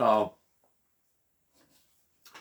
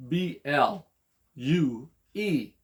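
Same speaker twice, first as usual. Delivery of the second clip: normal talking voice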